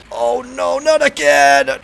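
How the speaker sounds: high loud wail, slowing on again; despairing shout. Real, not acted. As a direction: cry out in dismay. Car crashing again.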